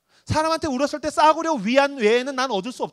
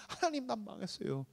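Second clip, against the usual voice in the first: much softer, crying lament to God; tearful prayer; 4 semitones higher, 10 dB quieter than usual. Acted. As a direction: pray through tears, voice breaking. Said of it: "막 울고 울고 울고 울다가". (울면서 하나님께 탄식하는 기도)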